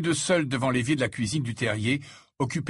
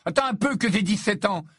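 higher-pitched indignant threat; angry cry; 9 semitones higher, 3 dB louder than usual. A narrator's reading. Ron warns his brother after cutting his thumb, angrily. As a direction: threaten him, annoyed. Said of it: "s'écria Ron avec colère".

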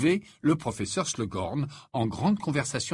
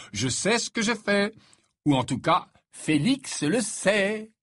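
raised, confident lilt; elated self-assurance; 8 semitones higher, 3 dB louder than usual. A narrator's reading. Harry assures friends about Felix potion, elated. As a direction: stretch the ending with sure delight.

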